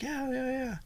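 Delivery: high, soft brush-off; distracted wife. Wife half-listening to her husband in the car, distracted. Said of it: "She wasn't really paying attention".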